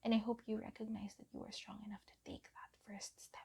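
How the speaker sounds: tender whispered affirmation; soft whisper. Acted. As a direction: whisper love and support close to the mic. Whispered encouragement.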